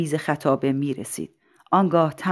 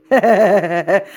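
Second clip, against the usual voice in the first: acted chuckling laugh; mayor's laugh; 3 semitones higher, 6 dB louder than usual. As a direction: laugh out in a quick run of ha-has. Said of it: "شهردار خندید و گفت". (خندهٔ نمایشی شهردار)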